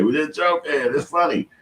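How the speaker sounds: high, loud mocking voice; mock quote. Mimicking the man to the chat, high and loud.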